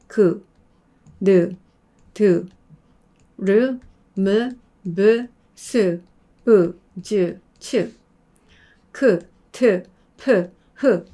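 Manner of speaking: 그 느 드 each with a full 으 vowel, slow; strong eu vowel readings. Shown as a demonstration. Teacher reading the consonant names the way students learned. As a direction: read the consonants the old school way, vowel fully voiced. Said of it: "이렇게 읽었다는 거죠".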